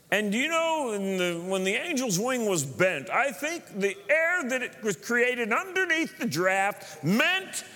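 slow, high-pitched mock earnestness; mimicking an obsessive questioner. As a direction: play the pestering man, earnest and rambling. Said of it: "coming up with some crazy question".

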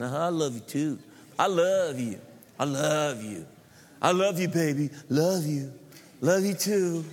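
mock sweet newlyweds cooing; lovey-dovey couple. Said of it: "look at each other and say".

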